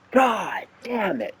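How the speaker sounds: high, slow, strained angry yell in a put-on voice; uncle blowing a gasket. Uncle yells at the singing women to shut up, furious high-pitched voice. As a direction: shriek at the cackling hens like a furious uncle. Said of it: "he just blows a gasket".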